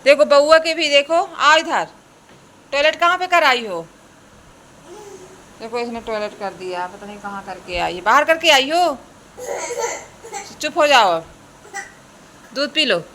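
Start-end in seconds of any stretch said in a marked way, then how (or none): 0.0-3.8 loud, high telling-off with a laugh; loud scolding. Real, not acted. Mother scolds her little girl about the toilet, loud. 8.0-13.0 loud, high scolding. Real, not acted. Mother tells crying girl to hush and drink milk, loud.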